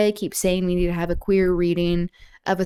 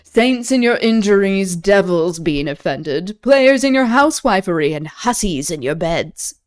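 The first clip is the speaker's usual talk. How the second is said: dramatic reading; 7 dB louder than usual. Acted. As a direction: declaim the greeting to the lady. Reads Iago's lines.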